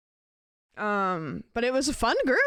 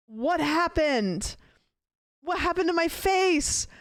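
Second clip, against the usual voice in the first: drawn-out, higher-pitched mock shock; mock dismay; 8 semitones higher, slower than usual. Streamer retells seeing their lined face to chat, mock dismay. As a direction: replay a horrified double take, slow and high. Acting out shock at the bathroom mirror.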